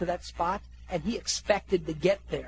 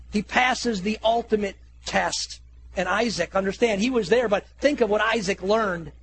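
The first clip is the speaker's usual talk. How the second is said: normal talking voice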